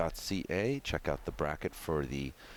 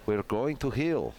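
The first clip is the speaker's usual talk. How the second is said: put-on Russian accent; 4 semitones higher, 3 dB louder than usual. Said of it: "commentate this match in a Russian accent".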